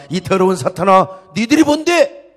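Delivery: loud, high-pitched indignant shouting; preacher thundering as an outraged God; God's fierce rebuke. Acted. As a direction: roar at Satan in defence of your people. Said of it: "이렇게 책망해 주시기를 바랍니다".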